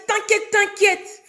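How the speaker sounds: slow, high, dismissive; imitation de l'amie. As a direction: drag it out, brushing off the warning. Amie imitée, désinvolte. (imitation lente et désinvolte de l'amie)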